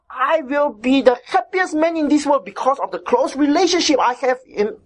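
normal talking voice

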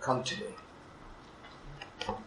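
breathed slowly, almost silent; slow hushed whisper of God's call; tender whisper. Acted. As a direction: whisper the invitation. God's call repeated softly.

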